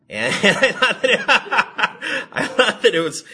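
high, loud laughing speech. Real, not acted. Preacher amused by the commentary, laughing. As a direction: talk through a burst of laughter.

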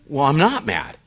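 irritated denial, pushed out harder; getting mad. Retold or imitated. Husband to wife after her pushing, a mildly irritated denial. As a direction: insist you're not mad while clearly annoyed. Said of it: "but I'm getting mad".

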